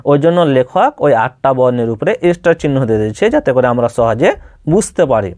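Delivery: usual speaking voice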